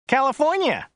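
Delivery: high, whiny chauffeur; Joe's whiny voice. A narrator's reading. Driver describing the client to his passenger, high and whiny. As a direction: pitch it up into a whine. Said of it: "His voice was rather high and whiny".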